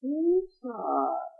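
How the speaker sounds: high soft oven voice; a hushed, high little oven reply. Acted. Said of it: "the oven said".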